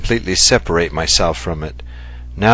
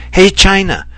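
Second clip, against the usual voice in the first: higher, louder mocking street heckle; rude catcall; 11 semitones higher, 5 dB louder than usual. Kaori imitating a rude Amsterdam stranger, mock catcall. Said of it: "someone said to me".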